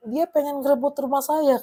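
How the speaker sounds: high-pitched, hammed-up outcry; mock yelling. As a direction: imitate an intruder shrilly refusing. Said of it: "Dia teriak".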